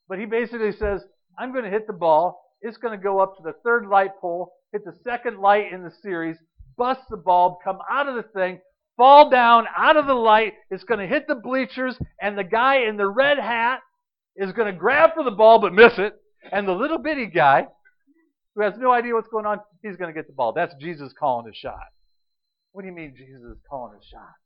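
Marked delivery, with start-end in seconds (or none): 1.4-20.5 loud, building sermon cry; rising preacher's shout; preaching at full force. Acted. Preaching the called shot. 22.7-24.3 soft, low sceptic's voice; questioning congregant; doubting listener. Pretend.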